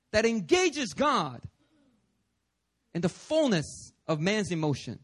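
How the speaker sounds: usual speaking voice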